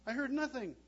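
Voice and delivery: high, loud mock denial. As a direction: pretend you heard nothing. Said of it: "you tell yourself".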